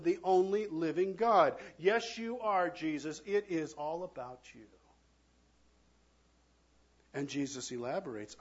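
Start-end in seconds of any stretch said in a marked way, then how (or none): none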